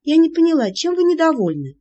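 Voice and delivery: high, loud pouting indignation. Acted. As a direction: say it with a sulky pout. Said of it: "Надулась я".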